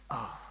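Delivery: hushed imitated groan of hurt; soft, pained sigh of a groan; inward groan. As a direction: groan under the breath, barely audible. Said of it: "so you couldn't hear him".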